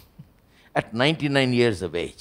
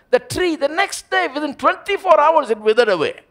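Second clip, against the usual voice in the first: higher and louder, driving each line home; fiery preaching; 7 dB louder than usual. Acted. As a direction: preach it at full force to the congregation. Preaching at full force.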